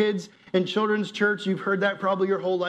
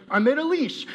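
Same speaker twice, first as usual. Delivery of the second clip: 1 proud exclamation; 8 semitones higher than usual. Retold or imitated. Proud of his makeshift leash.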